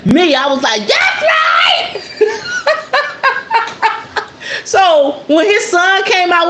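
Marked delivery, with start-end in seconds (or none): 0.9-2.0 shouting approval. Retold or imitated. Young woman shouting agreement at the preacher in church. 2.1-4.6 high, breathy burst of laughter. Real, not acted. Woman laughing at her own church outburst, high laugh.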